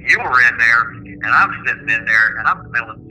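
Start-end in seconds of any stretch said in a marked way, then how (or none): none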